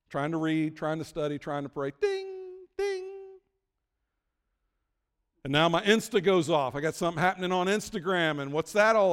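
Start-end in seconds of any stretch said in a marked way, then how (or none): 2.0-3.1 high-pitched phone ping; high ding sound. Retold or imitated. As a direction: chirp out a bright ding. Imitating phone pings.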